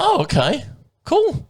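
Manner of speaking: high, amazed retold reaction; mock surprised. Telling a mate how he reacted to the stranger, mock surprised. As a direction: act out the stunned reply.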